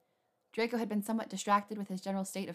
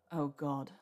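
moaning; 5 semitones lower than usual. A narrator's reading. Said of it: "Draco moaned".

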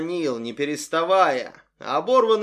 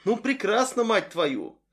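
higher annoyed exclamation; angry outburst; 7 semitones higher than usual. Acted. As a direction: snap it in exasperation. Frustrated outburst. (раздражённый возглас)

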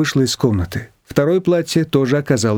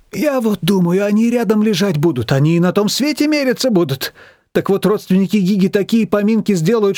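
put-on lightly raised voice of a fussy older woman; lightly voiced Manana; 7 semitones higher than usual. A narrator's reading. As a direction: chatter on, a bit worked up about the funeral. Манана рассуждает о похоронах. (слегка озвученная взволнованная Манана)

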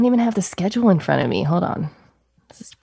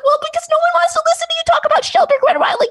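high fast exasperated quote; exasperated retort; faster than usual. Retold or imitated. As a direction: fire it back, pitched way up. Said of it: "And I'm like".

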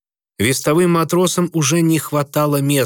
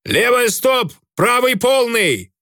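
barked orders into a speaking tube; high shouted command down a voice pipe; through a tube; 11 semitones higher, slower than usual. A narrator's reading. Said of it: "скомандовал он в переговорную трубу".

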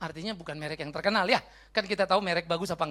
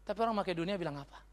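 usual speaking voice

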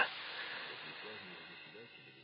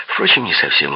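usual speaking voice

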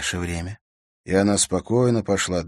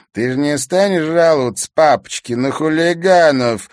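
loud, higher-pitched taunt; sneering, raised voice; snide question; 8 semitones higher, 9 dB louder than usual. A narrator's reading. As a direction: needle him with mock concern. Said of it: "ехидно спросил Бельцев".

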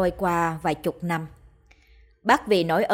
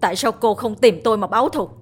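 louder and louder, breaking into a high cry; anguished shout; 4 semitones higher, 6 dB louder, faster than usual. Acted. Mạnh shouting at the ghost who killed his family, anguished. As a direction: scream the why at her in grief. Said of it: "đau đớn mà hét lên".

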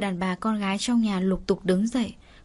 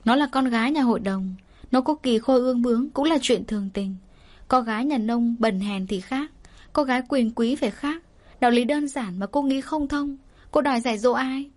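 ordinary delivery, nothing marked

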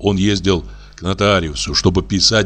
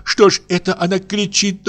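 put-on much higher, frightened old woman; Varvara in horror; 10 semitones higher than usual. A narrator's reading. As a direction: gasp it out aghast. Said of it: "бормотала она в ужасе". (старушка в ужасе, намного выше)

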